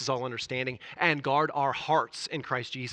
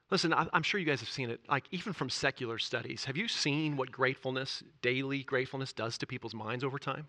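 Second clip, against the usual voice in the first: ordinary delivery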